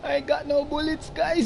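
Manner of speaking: high, laughing and panicked. Real, not acted.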